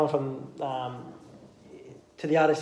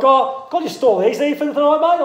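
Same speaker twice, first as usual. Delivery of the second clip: higher, louder mock-innocent ask; imitating Chad's request; 10 semitones higher, 6 dB louder than usual. Retelling Chad asking to store his chairs, mock-innocent. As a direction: play Chad asking a cheeky favour. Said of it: "And he's like".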